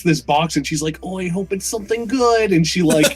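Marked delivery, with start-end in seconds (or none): 1.0-2.5 higher, hopeful gamer gush; mock excitement. Trailer player opening a loot box, imitated excitement. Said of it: "all excited".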